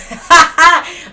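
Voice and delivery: big burst of real laughter; loud laugh. Real, not acted. Laughing at own double standard, loud real laugh.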